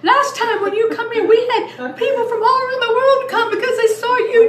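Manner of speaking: high, loud excited explaining; over-the-top imitation of eager staff; imitated clinic staff. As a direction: gush loudly in a put-on high voice.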